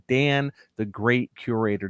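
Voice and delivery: normal talking voice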